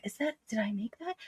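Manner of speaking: quietly mimicked disbelief; hushed wondering. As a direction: ask it small and under your breath. Samantha wondering at the stranger.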